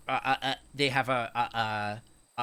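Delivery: usual speaking voice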